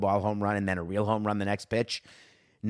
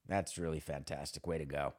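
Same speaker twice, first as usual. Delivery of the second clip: low, soft sarcastic praise; deadpan sarcastic praise; 8 semitones lower, 12 dB quieter than usual. Real, not acted.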